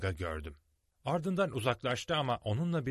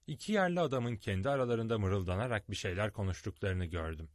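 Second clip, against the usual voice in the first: ordinary delivery, nothing marked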